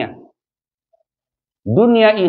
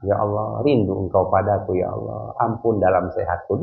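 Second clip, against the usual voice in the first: soft prayer; 8 semitones lower, 5 dB quieter than usual. Shown as a demonstration. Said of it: "kita bilang apa".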